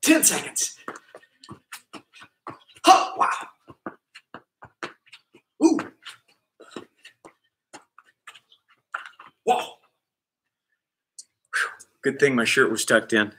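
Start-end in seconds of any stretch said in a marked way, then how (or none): 0.0-9.7 breathless whisper. Real, not acted. Mid-dance, breathless asides.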